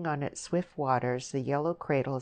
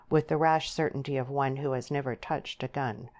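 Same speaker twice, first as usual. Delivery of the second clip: plain reading voice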